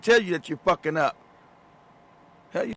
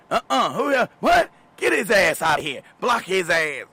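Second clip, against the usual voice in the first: mock outraged women; 3 dB louder than usual. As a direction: squawk at him like an angry mob. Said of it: "descended on my ass like a bird of prey".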